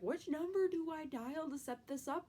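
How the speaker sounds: high, drawn-out confused question; hammed-up puzzlement; mock bewilderment. Acting out own sick confusion.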